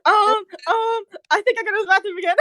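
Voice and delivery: high voice, hesitant then rushed; stall, then hurry the words out; sheepish hedging. Acted. Hyden telling angry Kira his plan, high sheepish hedging.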